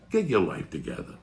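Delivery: ordinary talk, nothing marked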